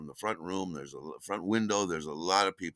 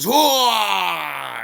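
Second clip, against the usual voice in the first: long, high, loud mock drunk howl; imitated drunken holler; 12 semitones higher, 11 dB louder than usual. Drunk looks up at the delivery man and swings, imitated loud howl. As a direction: holler wordlessly like a startled drunk.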